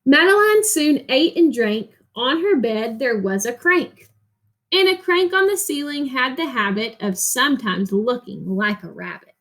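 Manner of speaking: put-on reading a picture book to children, rhythmic and lilting; expressive read-aloud narration; story reading. Reads story aloud to class.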